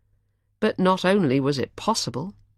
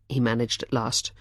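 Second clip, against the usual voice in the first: ordinary delivery, nothing marked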